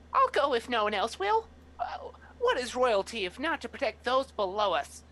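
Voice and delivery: put-on high, loud puffed-up child; a small child playing brave; child rat yelling. Child rat shouting bravely to the court. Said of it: "rat yelling this out".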